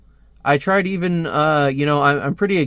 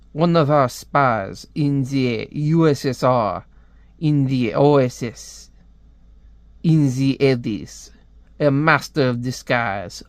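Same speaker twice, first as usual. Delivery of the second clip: slow, put-on French accent; brief them like a French spymaster; slower than usual. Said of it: "I hate doing a French accent".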